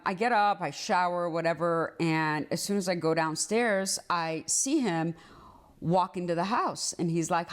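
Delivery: usual speaking voice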